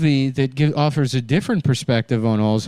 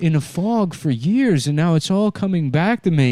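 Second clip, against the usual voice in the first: put-on higher, joyful old man voice; grandpa remembering; 6 semitones higher than usual. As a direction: let memories flood back with delight. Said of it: "he's like".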